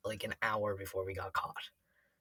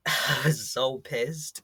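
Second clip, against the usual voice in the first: laughing; 3 semitones higher, 6 dB louder, much slower than usual. Real, not acted. Laughing recall of anger.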